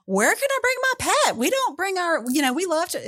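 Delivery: high, bright retelling of eager visitors asking; excited visitors. Retold or imitated. Visitors from Louisiana asking about pets, high and eager. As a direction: play the excited out-of-town visitor. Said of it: "And they're like".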